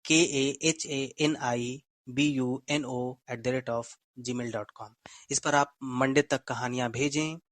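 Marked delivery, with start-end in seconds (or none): 0.1-4.9 slow, careful dictation, letter by letter; slow spelled-out email. Shown as a demonstration. Host dictating the email address to listeners, slow and clear. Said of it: "मैं फिर से एक बार mail ID दोहरा देता हूँ".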